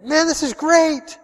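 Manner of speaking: high, loud burst of joy; excited. Pretend. Soul winner rejoicing, high excited voice. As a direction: lift it up like good news. Imagined joyful inner voice.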